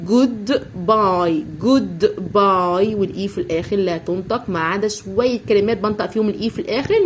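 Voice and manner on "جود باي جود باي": جود باي stretched very slowly; slow model word. Shown as a demonstration. Teacher to children, stretching جود باي slowly. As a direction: draw out جود باي syllable by syllable. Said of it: "فبقول له جو".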